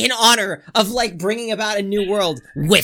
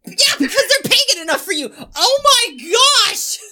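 high laughing shout of disbelief; 12 semitones higher, 3 dB louder than usual. Real, not acted. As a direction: laugh it out, stunned.

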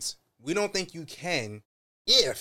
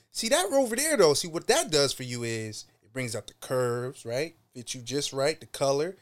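ordinary delivery

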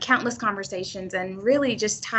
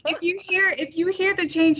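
high-pitched, giddy with excitement; real excitement; 9 semitones higher than usual. Real, not acted. New teacher explaining her changed voice to the host, excited. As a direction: voice lifted by pure excitement. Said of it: "it's just purely excitement".

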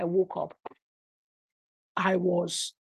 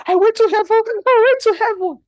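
much higher, louder joyful announcement; wife bursting awake with good news; excited shout; 15 dB louder than usual. Retold or imitated. Wife announcing vision to husband.

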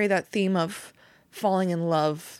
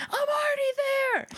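excited yell; faster than usual. Retold or imitated. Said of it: "And I said".

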